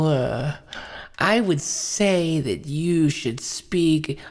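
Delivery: ordinary talk, nothing marked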